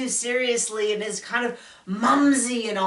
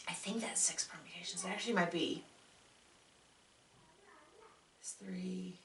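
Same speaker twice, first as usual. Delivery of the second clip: much softer, half-under-breath counting aloud; muttering to herself; 17 dB quieter than usual. Real, not acted. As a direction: drop out of the conversation and count to yourself.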